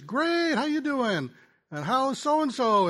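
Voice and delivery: high, bright mock phone hello; retelling his own chipper hello to the caller; cheery greeting. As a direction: sound delighted to pick up the call.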